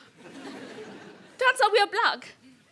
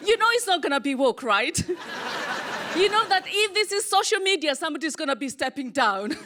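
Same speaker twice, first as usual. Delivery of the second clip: normal talking voice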